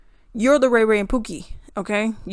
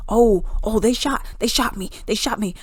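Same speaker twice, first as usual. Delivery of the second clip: whiny, fake-hurt cop voice; mock panicked cop. Ridiculing the officer's false claim, a whiny fake-panic voice. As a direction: play a cop faking that he was shot. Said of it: "He said it like".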